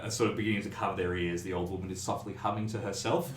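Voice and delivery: ordinary talk, nothing marked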